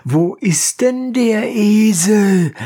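slow, high, flustered judge; embarrassed, stalling. A narrator's reading. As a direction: ask it high and drawn out, playing for time. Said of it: "in großer Verlegenheit".

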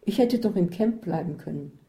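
usual speaking voice

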